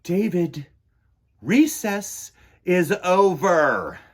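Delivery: loud, slow scolding; stern teacher. A narrator's reading. Teacher telling David off for gum, slow and stern. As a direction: spell out each word like a strict teacher.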